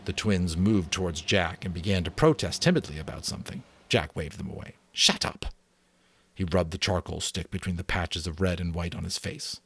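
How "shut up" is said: sharp unvoiced shut up; hissed order. Jack waves the twins away, hissed order. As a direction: snap at them under your breath.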